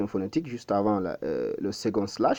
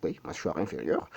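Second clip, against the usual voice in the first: normal talking voice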